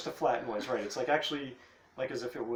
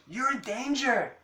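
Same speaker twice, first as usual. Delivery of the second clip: high, slower creature warning; sea cat warning; 12 semitones higher, 4 dB louder than usual. Acted. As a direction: warn her in an eerie high voice. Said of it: "So he says".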